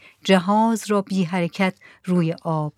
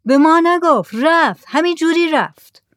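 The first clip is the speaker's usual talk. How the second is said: high, raised calling voice; shouting back; 9 semitones higher, 6 dB louder than usual. A narrator's reading. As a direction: holler the answer out to the boat. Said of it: "زکریا گفت". (با صدای بلند جواب دادن)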